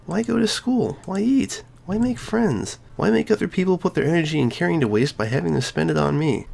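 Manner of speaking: put-on high girlish voice, hurt and pleading; a cheerful girl cracking open about her pain; Sayori's voice. Sayori confesses depression.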